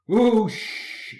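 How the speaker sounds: high, loud steam whoosh; hissing whoosh. A narrator's reading. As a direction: blast it like escaping steam. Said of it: "he hissed".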